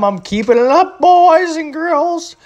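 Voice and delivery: put-on high, slow children's host voice; kids' show sign-off. Vlogger signing off to viewers like a kids' show host. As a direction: sing it to the boys and girls.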